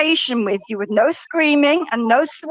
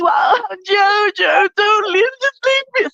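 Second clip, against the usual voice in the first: higher, badly faked sobbing plea; fake crying; 5 semitones higher, slower than usual. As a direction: put on crocodile tears. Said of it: "See, I can do that".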